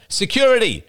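loud, high call for help. A narrator's reading. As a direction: shout for the guards. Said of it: "raised his voice again".

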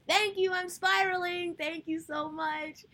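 high, loud thanks with drawn-out words; loud outburst. Real, not acted. Thanking a friend while spiraling, loud high outburst.

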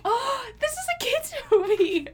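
high-pitched scandalized protest with a laugh; playfully appalled, voice way up; mock outrage. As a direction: squeal in disbelief, half laughing. Retold shocked reaction to dialogue.

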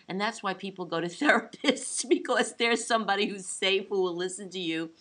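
normal talking voice

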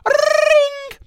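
very high, loud ringing phone; phone ring imitation.

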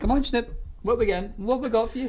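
high sing-song voice for a dog; talking to a dog. Retold or imitated. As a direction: coax the dog in, pitched high. Calling dog in for dinner.

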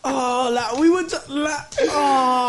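high voice breaking up with laughter; helpless laughter. Real, not acted. Friend reacting to the booked flight back, helpless laughter. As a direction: groan through uncontrollable laughing.

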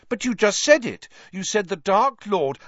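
high, loud insisting; insistent. Acted. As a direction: press the point urgently. Harry insisting loudly.

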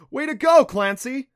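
high, very loud congratulation; elated cheer. A narrator's reading. Adam congratulating Clancy on the bubble, cheering. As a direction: cheer a friend on at full voice.

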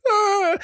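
high, squeaked cry of pain; a squeak from under a crushing weight; mock yelp. Man crushed under woman, retold.

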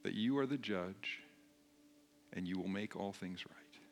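soft, low-key prayer with long pauses. Real, not acted. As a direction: lead them gently in prayer, pausing between phrases. Leads the congregation in prayer.

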